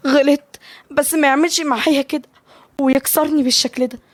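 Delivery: high, wounded complaint; sad. A narrator's reading. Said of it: "مليكة بحزن".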